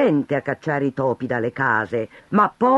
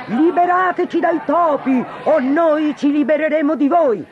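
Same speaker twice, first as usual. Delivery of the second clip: high, loud threatening chant; crowd yelling threat; 13 semitones higher, 5 dB louder than usual. Acted. Crowd threatens the mayor, loud and high. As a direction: roar it at the mayor, much higher.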